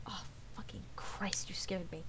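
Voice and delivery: hushed, startled swearing; startled whisper. Real, not acted. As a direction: swear under your breath after a scare. Startled by something mid-chat.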